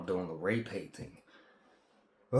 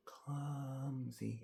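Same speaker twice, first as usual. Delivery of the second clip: soft intended word; 14 dB quieter than usual. Retold or imitated. Trying to tell a lady he is clumsy, said softly. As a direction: breathe Clumsy as the word you meant to say. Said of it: "When you're going".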